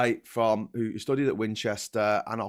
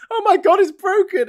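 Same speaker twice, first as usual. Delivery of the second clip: very high, loud feigned shock; mock horror; 12 dB louder than usual. Mimicking the politician's feigned shock, mock horror. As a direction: wail it in hammed-up dismay. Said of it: "You know, it's like".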